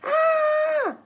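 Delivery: high loud yelp, cartoonish; a startled little kid's cry; not a scream. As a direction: kid insists it was no scream. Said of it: "It wasn't a scream".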